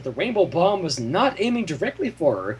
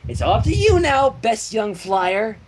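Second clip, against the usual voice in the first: higher, earnest send-off; lightly voiced Pinky; 4 semitones higher than usual. Pinky saluting Dash before her flight. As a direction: hand it over with pride.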